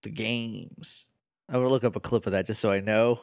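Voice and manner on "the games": low, much softer gruff mumble of games; mock Danny DeVito. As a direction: growl the word games like Danny DeVito's Phil. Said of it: "I'm gonna say it like that".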